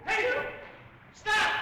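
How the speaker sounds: high frantic yell; shouting. Acted. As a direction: yell in alarm.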